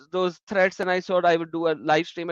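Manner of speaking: ordinary talk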